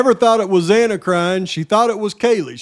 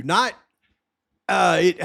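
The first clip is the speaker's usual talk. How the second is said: ordinary talk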